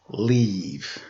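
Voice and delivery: low, soft dry advice; soft deadpan moral; deadpan punchline. A narrator's reading. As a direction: drop it flat as the joke lands.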